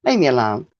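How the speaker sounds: ordinary delivery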